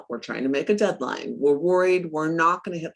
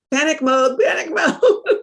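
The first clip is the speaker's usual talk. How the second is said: laughing through panic mode, higher and louder; 10 semitones higher, 7 dB louder than usual. Retold or imitated. Woman laughing at her own panic over the deal, laughing. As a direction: giggle it out, the second one stretched slow.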